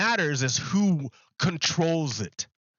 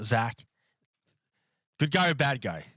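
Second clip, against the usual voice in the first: ordinary talk, nothing marked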